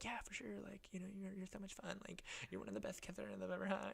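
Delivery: high, very soft mock girl voice; sweet-talking girl. Girl saying goodbye after a kiss, mock sweet-talk. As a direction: coo it high and hushed, imitating her. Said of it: "And she's like".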